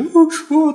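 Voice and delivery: high mock sobs of a hired mourner, not real grief; a paid mourner's put-on wail, high and hammed-up. Hired mourners at Jairus's daughter's house, mock wailing. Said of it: "ってずっと泣いてる".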